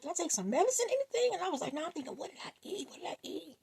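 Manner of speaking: high, puzzled self-questioning; groggy wondering. Retold or imitated. Just woken, high puzzled self-questions. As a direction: ask yourself high and dazed.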